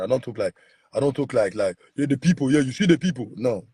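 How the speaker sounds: ordinary delivery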